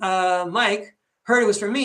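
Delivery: usual speaking voice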